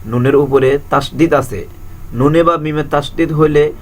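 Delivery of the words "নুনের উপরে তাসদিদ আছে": normal talking voice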